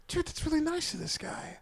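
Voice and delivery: high, hushed aside; inner thought. Retold or imitated. His drunk inner thought.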